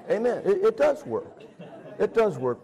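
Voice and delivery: amen said through laughter; laughing. Real, not acted. Preacher laughs while telling congregation it works, laughing. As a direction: still chuckling as you affirm.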